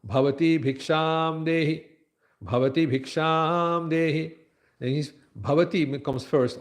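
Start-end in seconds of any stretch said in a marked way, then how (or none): 0.1-4.3 slow Sanskrit call on a raised, held pitch; begging boy's call. Acted. Brahmin boy addressing a good woman, slow intoned call. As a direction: intone the plea for alms, long vowels. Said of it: "And if he says".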